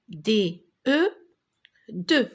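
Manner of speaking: D, E, then "deux" with no X sound; teacher sounding out "deux", X left silent; silent final letter. Shown as a demonstration. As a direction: spell D, E, then say "deux" ending on the vowel. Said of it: "je ne la prononce pas".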